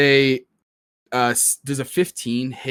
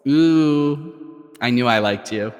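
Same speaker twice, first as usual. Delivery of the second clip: flirty villain; slower than usual. Acted. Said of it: "she laughs a little bit and goes".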